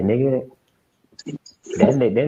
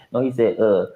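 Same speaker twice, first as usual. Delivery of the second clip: ordinary delivery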